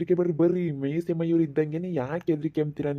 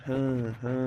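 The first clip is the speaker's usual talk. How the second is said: low soft sly chuckle; a quiet gloating laugh; villain laugh; 3 semitones lower, 5 dB quieter, much slower than usual. Acted. Villainous laugh while stealing. (ಮೆಲ್ಲನೆ ಖುಷಿಯ ಖಳನಗು)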